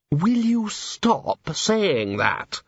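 slow, high, strained demand; exasperated. Acted. Plotter is sick of the guest's refrain, exasperated. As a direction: drag the words out, out of patience.

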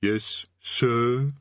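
drawn-out, unenthusiastic "sir"; grudging underling. A narrator's reading. Underling corrected by the Demon King, grudging. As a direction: add the title reluctantly. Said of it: "The distant voice muttered something".

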